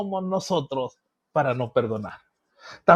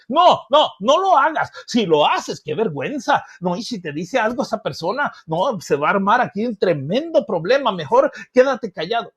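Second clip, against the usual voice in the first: insistent inner voice, raised and urgent; louder second voice; 3 semitones higher, 3 dB louder than usual. Acted. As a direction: pester them out of it, forcefully. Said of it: "oye otra voz más fuerte".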